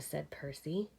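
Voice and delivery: usual speaking voice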